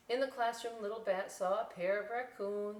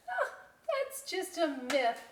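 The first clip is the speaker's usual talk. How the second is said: put-on little kid voice; 9 semitones higher, 5 dB louder, slower than usual. Little Bat voice.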